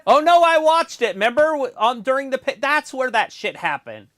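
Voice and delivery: loud, high burst of recall; excited realization. Real, not acted. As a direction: blurt it out suddenly remembering.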